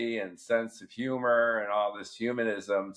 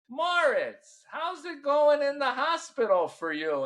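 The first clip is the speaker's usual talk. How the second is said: high, sweet wife voice raised well up; wife's voice; 14 semitones higher than usual. Retold or imitated. Wife visiting husband in hospital, high sweet voice.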